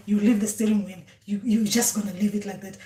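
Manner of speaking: normal talking voice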